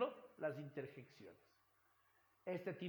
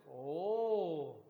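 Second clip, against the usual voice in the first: loud drawn-out gasp of surprise; a mock startled oh; surprised oh; 7 dB louder than usual. Shown as a demonstration. Teacher illustrating surprise to students with a loud oh. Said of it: "Cuando nos sorprendemos".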